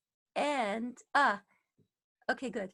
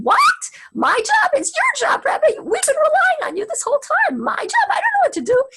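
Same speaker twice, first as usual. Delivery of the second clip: high, loud, pleading voice of the followers; mock protesting crowd; 18 dB louder than usual. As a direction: squeal out the people's panic, pitched way up. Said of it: "They said".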